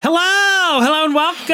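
shouted high game show welcome; game show host. Pretend. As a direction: boom it out like a host opening the show.

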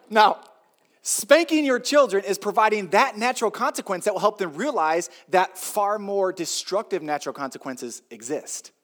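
ordinary talk

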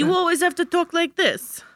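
broad New York Italian voice; hammed-up New York Italian; New York Italian accent. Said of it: "a New York accent".

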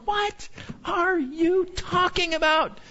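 high, slow, incredulous question; baffled. Retold or imitated. Preacher voices listener's disbelief at the grumblers, baffled. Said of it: "You're going".

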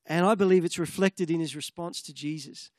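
ordinary talk

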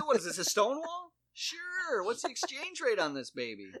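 very high, loud mock-cheery voice; hammed-up cashier. Cashier mocking the customer, high and loud. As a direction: gush over the Confederate note. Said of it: "I said".

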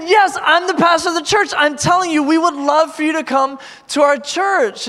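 high, loud, eager assurance; excited insistence. Retold or imitated. Pastor convincing two girls they are welcome, high and excited. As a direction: insist at the top of your range. Retold emphatic, excited invitation.

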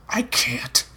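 breathless whisper of refusal; unvoiced, hushed confession. A narrator's reading. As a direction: barely voice the words.

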